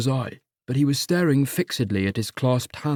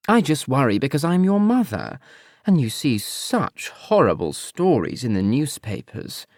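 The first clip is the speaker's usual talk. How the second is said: disapproving mother; 2 semitones higher than usual. Acted.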